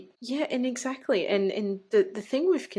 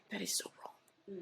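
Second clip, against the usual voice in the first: whispered; 3 semitones higher, 12 dB quieter than usual. Real, not acted. Sad remark on doctors' conduct.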